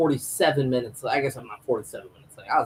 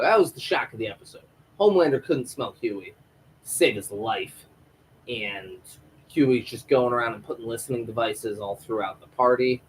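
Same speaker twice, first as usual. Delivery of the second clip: ordinary delivery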